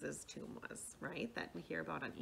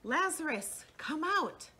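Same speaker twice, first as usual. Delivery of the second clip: high, raised summoning call; loud narrated command, pitched up; 8 semitones higher, 5 dB louder, slower than usual. A narrator's reading. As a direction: call Lazarus out from the tomb. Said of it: "he called out in a loud voice".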